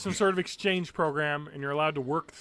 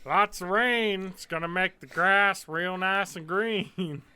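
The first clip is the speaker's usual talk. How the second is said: put-on slow, drawling old-timer voice; old person voice; 4 semitones higher, 4 dB louder, slower than usual. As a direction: talk like a slow old farmer. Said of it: "I sound like an old person".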